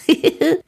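put-on high boyish giggle; a boy giggling at grandpa's crush. A narrator's reading. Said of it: "kichert Resus".

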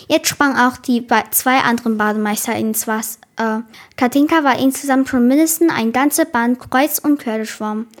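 ordinary delivery, nothing marked

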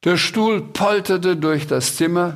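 usual speaking voice

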